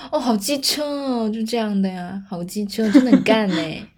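put-on high bubbly Taiwanese accent; chirpy Taiwan variety-show voice. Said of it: "听他们用台湾腔".

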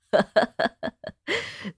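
soft rapid chuckle; loose, breathy run of laughter. Acted. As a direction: chuckle to yourself over the drink. Drinker laughs before calling for wine.

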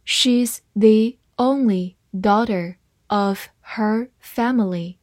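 slow, one word at a time; measured teacher's read-along. Shown as a demonstration. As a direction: leave a clear gap after every word. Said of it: "慢慢来读".

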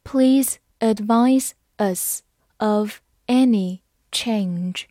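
slow, deliberate, word by word; slow read-along. Shown as a demonstration. Teacher invites learners to read along, slowly and separately. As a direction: pause after every word so learners can repeat. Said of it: "跟我慢读一遍".

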